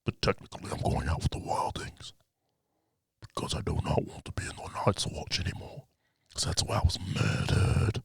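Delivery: hushed mock Jon; whispered Jon quote. Voicing Jon telling Arya his plan, whispered. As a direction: whisper it like a secret confession.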